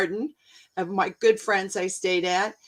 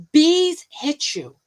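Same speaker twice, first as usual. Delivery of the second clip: higher, louder and very slow; emphatic; 8 semitones higher, 6 dB louder, much slower than usual. Real, not acted. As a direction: land each word hard. Warning listeners about bees.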